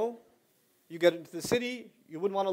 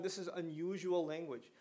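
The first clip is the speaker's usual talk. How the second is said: ordinary talk, nothing marked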